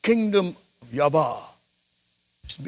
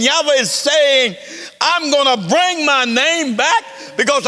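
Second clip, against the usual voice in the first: higher, urgent preacher's proclamation; preaching; 7 semitones higher, 4 dB louder than usual. Acted. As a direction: build the message up with fire.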